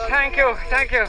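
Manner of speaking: loud thanks. Real, not acted. Thanking the crowd loudly.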